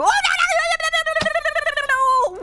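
high, rapid string of no's; frantic panic. Real, not acted. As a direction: panic as she nearly catches you. Chased, frantic repeated no.

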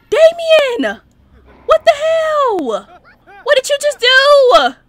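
real high, loud cries of disbelief; shocked outcry. Real, not acted. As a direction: cry out in horror at the killing. Sim kills gang members unexpectedly.

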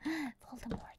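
hushed mock-horror whisper after a gasp; gasp, then whisper the dreaded name. Player guessing what else it could be, whispered mock dread. Gasped joke answer to guessing.

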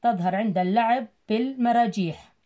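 normal talking voice

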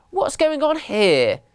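high, slow demanding question; indignant challenge. A narrator's reading. Mummy elephant confronting the mice, indignant challenge. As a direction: draw it out high, demanding an answer.

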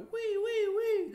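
thin high falsetto squeaks; falsetto wee. Shown as a demonstration. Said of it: "that's not head voice, that's falsetto".

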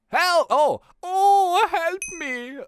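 loud, high, hammed-up pleading; captive crying for help. Voices the captive calling for help.

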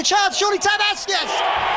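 high excited shout; goal yell. Real, not acted. Commentator calls a goal.

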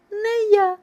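high mouse cry; calling out. A narrator's reading. Mouse refusing to carry grain, calling out. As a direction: pipe a refusal out as a little mouse. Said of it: "гукає Круть".

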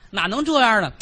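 high, loud wifely scold; the wife chides her husband, loud and high. Acted. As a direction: scold him like an aggrieved wife.

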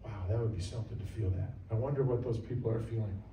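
soft, low, quick musing; quiet wonder. Pretend. Churchgoer watching worship, quietly wondering. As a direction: murmur in awe to yourself.

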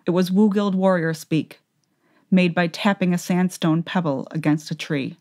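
ordinary delivery, nothing marked

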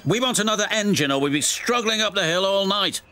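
high-pitched, rapid grumbling; griping trucks, high and insistent. A narrator's reading. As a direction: complain quickly in a high character voice. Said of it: "The trucks were still grumbling".

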